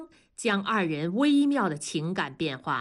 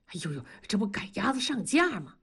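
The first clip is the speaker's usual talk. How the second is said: quick, flustered protest; awkward reluctance; faster than usual. A narrator's reading. Worker pushed to perform for the crowd, reluctant and flustered. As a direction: wriggle out of it, embarrassed. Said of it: "同志为难地说".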